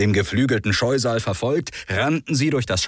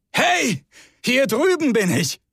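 calling out. Acted. Rick lures the monster away from Hubert, high shout. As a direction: draw the beast to yourself.

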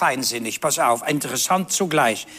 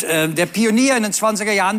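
ordinary talk, nothing marked

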